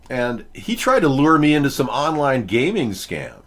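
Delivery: ordinary talk, nothing marked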